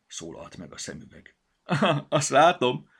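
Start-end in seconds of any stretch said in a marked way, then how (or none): 1.6-2.9 laugh, then a higher, louder quip; laughing reply; chuckling. Acted. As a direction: giggle at the funny name. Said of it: "nevetgélt Petya".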